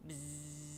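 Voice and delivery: soft imitated buzzing; playful bee buzz. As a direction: buzz like a bumblebee.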